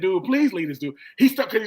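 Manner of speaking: normal talking voice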